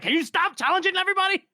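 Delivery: high, fast mock-angry shout; exasperated yell. Bethod fed up with Logan's challenging, exasperated yell.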